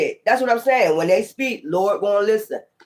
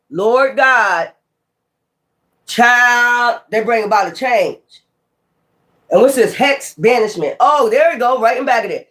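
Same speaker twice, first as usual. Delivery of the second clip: normal talking voice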